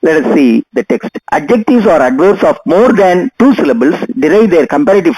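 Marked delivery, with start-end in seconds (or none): none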